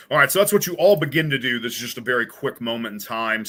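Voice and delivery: usual speaking voice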